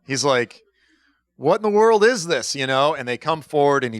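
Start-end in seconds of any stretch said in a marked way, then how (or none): 1.4-2.5 higher-pitched mock bafflement; retold astonished exclamation; baffled. Retelling a prophet's reaction to an odd word, baffled.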